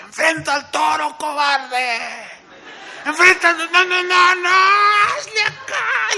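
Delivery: high, loud shouting at the matador; grandmother heckling. Retold or imitated. Grandmother heckling the bullfighter as a coward, shouting. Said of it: "mi abuelita se paraba y decía".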